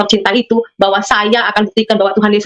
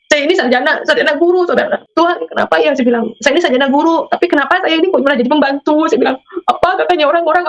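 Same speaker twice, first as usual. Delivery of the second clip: raised, pleading complaint to God; retold tearful lament; 6 semitones higher than usual. Woman washing dishes in Malaysia complains to God, mildly tearful and higher. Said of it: "saya nangis".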